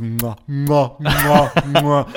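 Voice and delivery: imitated smacking kiss noises; exaggerated "mwah" kisses, one after another; comic kissing noises, building in sloppiness; sloppy kisses. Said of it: "each one seems to be more sloppy than the last".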